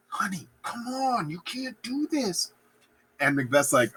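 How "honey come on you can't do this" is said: high, soft, slow coaxing; hushed mock pleading. Lady Macbeth coaxing her husband aside, high hushed pleading. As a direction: plead under cover, out of guests' hearing.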